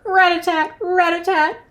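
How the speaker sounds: high rapid-fire tapping; woodpecker call. A narrator's reading. Woodpecker in the noisy recap, high tapping call. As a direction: rattle it off like a woodpecker. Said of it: "The woodpecker".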